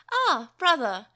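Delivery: happy call. A narrator's reading. Greeting brother happily.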